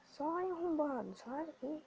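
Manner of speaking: high, drawn-out exasperated plea. Real, not acted. Gamer telling rival player to get out, exasperated. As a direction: push the rival away with a high whine.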